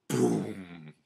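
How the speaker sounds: drawn-out "boom" of a blow; booming impact noise. Acted. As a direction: voice a heavy hit. Imitates blows sending animals flying.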